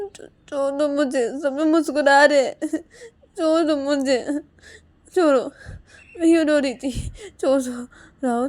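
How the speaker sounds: high, loud, half-laughing plea; flustered pleading. Acted. Embarrassed plea to be let go. (घबराकर, ऊँची आवाज़ में मिन्नत)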